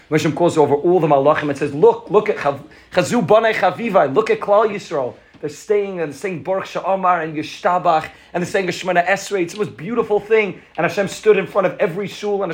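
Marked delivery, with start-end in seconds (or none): 1.7-10.6 proud delighted boast. Acted. God boasting to the angels.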